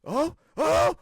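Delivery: high, loud thrilled cry; excited yell. Retold or imitated. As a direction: shout in giddy disbelief. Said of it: "sitting on my couch going".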